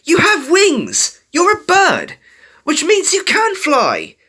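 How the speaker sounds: high, loud, drawn-out burst of encouragement; urgent high-pitched pep talk; sudden excited outburst. A narrator's reading. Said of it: "Dash suddenly burst out".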